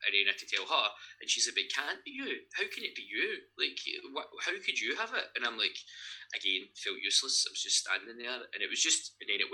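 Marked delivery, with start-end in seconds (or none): 1.6-5.3 high-pitched imitated disbelief; retold disbelief. Sister on hearing the cancer news, retold disbelieving. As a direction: plead in disbelief, voice swinging high.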